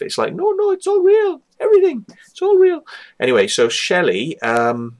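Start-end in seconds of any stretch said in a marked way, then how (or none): 0.3-2.8 high falsetto insistence, mocking a true believer; hammed-up imitation of gullible excitement; mock freak-out. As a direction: insist in a squeaky put-on high voice. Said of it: "freaking out, going".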